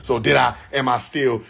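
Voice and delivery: usual speaking voice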